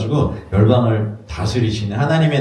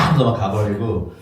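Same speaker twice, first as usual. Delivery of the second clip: normal talking voice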